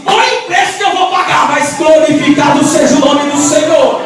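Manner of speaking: shouted vow. Acted. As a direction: belt out the believer's defiant vow. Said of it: "A crente fala assim".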